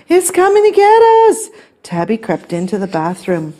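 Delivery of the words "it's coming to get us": high, rushed alarm; panicked cry. A narrator's reading. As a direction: blurt it out in storybook terror. Stanley panics about ghost.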